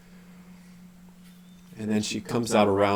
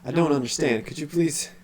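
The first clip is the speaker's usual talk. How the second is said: confused character voice; 6 semitones higher, 3 dB louder than usual. Acted.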